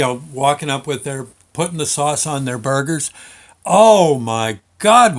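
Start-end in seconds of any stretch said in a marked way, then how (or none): 3.7-5.2 slow, loud "oh my God" of pleasure; drawn-out delight. Real, not acted. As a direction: stretch it out, overwhelmed by the memory. Remembering how good it was.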